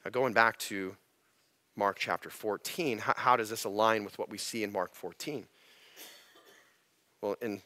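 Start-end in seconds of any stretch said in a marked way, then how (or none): none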